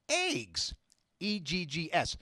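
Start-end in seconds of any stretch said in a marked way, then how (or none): 0.1-0.7 Eggs said high and drawn out with a falling end; Eggs, the way people tease him for saying it; his own way of saying eggs. Shown as a demonstration. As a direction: stretch out Eggs in a high sing-song. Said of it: "people make fun of the way I say eggs".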